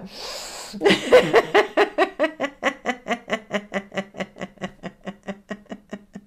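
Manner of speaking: long helpless giggle fit; on laughing gas. Pretend. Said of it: "encore une fois".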